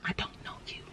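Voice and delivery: breathy whisper, no voice at all; whispered repeat. Retold or imitated. Whispering it to the camera in disbelief. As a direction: drop to a whisper for the second time.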